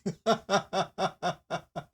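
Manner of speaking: short helpless laugh. Real, not acted. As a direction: giggle fading out. Laughs at own misreading.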